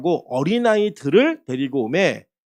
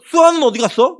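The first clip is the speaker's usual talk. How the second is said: higher-pitched imitated child's question; child asking; 6 semitones higher, 4 dB louder than usual. Child asking the pastor after someone, lightly imitated. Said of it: "이거 물어요".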